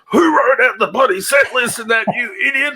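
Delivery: hammed-up angry rant; irate drummer. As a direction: demand an answer like a fed-up bandmate. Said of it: "sit there behind the drum kit and go".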